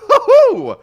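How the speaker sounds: loud, high-pitched "woohoo"; excited whoop. Real, not acted. Caster delighted by Quidditch news, excited whoop. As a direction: let out a big whoop. Joking reaction to Quidditch being real.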